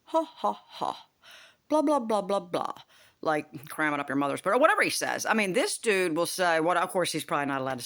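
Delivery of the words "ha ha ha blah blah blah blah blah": hammed-up mocking teacher. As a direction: sneer it like a gloating teacher. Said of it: "he's just like".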